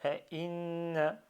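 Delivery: "A, in" as separate pieces, "in" held; cut into parts. Shown as a demonstration. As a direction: break off after "A, in", holding the n. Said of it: "je coupe sur deux".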